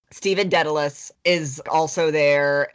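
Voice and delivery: ordinary talk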